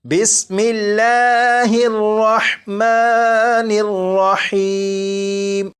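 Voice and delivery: very slow drawn-out recitation of بسم اللہ الرحمن الرحیم; recitation demo. Shown as a demonstration. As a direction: chant بسم اللہ الرحمن الرحیم slowly, Quranic style. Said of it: "جیسے".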